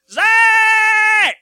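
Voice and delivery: imitating grandmother's very high, loud call; piercingly high supper-time yell, mimicked; high-pitched holler. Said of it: "She had a very high-pitched voice".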